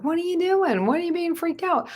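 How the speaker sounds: high, puzzled dog voice; squeaky dog asking questions; voicing the dog. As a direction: play the curious dog, squeaky. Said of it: "they're just following around like".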